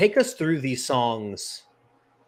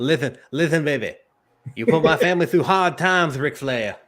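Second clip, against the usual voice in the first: louder, higher wrestling promo voice; mock wrestler calling out Ric Flair, louder and higher; 4 semitones higher, 5 dB louder than usual. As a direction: cut a promo on the mic. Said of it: "I love doing wrestler impressions".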